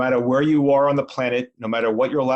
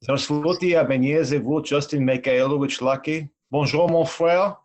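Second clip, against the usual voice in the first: spoken French. Shown as a demonstration. Guest greets the host in French. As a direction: greet your brother in French. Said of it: "in six different languages".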